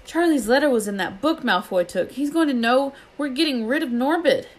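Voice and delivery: high, rising panic; frantic sick boy realising the danger; panicked. Acted. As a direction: blurt it out in alarm. Ron panics in bed.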